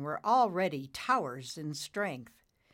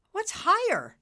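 very high, loud bewildered query; baffled question; 8 dB louder than usual. Acted. Nimbus puzzled by Dad's hint, baffled. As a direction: ask it in a baffled squeak.

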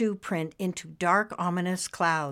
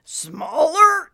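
high, loud, strained question; straining not to burst; grunting, breath held; 9 semitones higher, 8 dB louder than usual. Acted. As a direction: squeeze the word out on held breath. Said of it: "grunting, holding his breath".

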